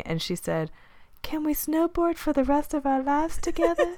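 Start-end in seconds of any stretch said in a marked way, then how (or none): 1.2-4.0 high, airy put-on voice; acted romantic plea, pitched way up; dreamy heroine. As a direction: coo it like a movie heroine. Acting Sarah's reply to proposal.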